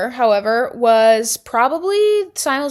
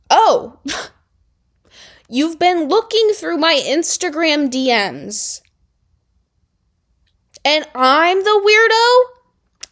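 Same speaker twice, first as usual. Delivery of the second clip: sarcastic mock disbelief; 5 semitones higher, 4 dB louder, slower than usual. Mocking retold comeback to Ella.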